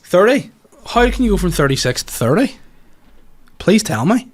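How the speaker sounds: high, incredulous questioning; mock-exasperated disbelief. Real, not acted. Host querying co-host's count of thirty-six then thirty, incredulous. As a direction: demand an explanation, pitch climbing at the end.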